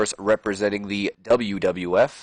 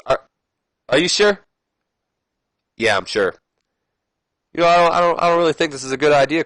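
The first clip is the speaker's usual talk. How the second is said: nervous Ric Flair; 5 semitones higher, faster than usual. Acted. Narrator voices worried Flair.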